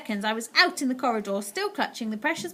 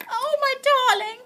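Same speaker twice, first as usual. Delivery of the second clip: much higher, louder, relieved; relieved cry; 7 dB louder than usual. A narrator's reading. Grandmother hugging her grandson, relieved. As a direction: pour out relief. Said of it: "she cried".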